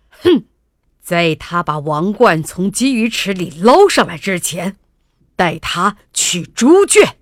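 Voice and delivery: loud huff, then a furious royal order; angry king. A narrator's reading. King banishing his daughter to the pigsty, angry. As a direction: play the fuming king laying down a punishment. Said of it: "国王气得头发都竖起来了".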